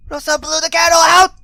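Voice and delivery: loud, high alarmed reading; excited exclaim. Real, not acted. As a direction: blurt it out in alarm. Alarm at candle going out.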